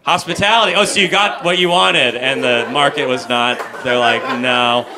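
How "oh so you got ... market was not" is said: normal talking voice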